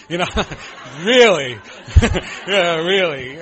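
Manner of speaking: laughing, loud, disbelieving really; incredulous laugh. Retold or imitated. Retelling the counselor talk, laughing loud disbelief. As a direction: scoff at the idea with a big laugh.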